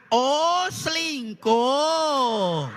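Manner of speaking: loud, drawn-out slow exclaim; priest shouts loudly. Acted. Deaf priest shouting the sin back, slow and loud. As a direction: blurt the sin to the whole church. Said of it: "[name] teriaknya lebih keras".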